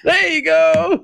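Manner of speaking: very high, stretched-out praise. Real, not acted. Cheering the child's louder greeting.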